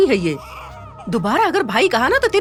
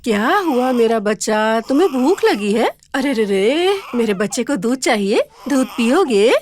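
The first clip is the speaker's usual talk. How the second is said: put-on slow, tender cooing to a baby; tender comforting; slower than usual. Mother lovingly soothing her crying baby son, slow and tender. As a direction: hush the baby gently, slowly.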